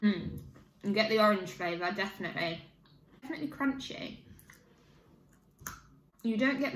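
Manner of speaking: chewing while talking; speaking between bites; mouth full of biscuit. Real, not acted. Seen 0: Snaps biscuit, bites at 1.5s, chews through rest of words.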